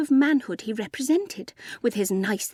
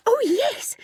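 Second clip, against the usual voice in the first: put-on much higher-pitched girlish reply; Ethel's eager voice; 13 semitones higher than usual. A narrator's reading. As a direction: answer eagerly in a high voice. Ethel accepts lunch in story.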